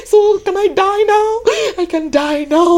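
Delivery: put-on high, overjoyed old man; elated Simeon. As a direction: sigh it out like a man whose wait is over. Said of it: "Simeon said".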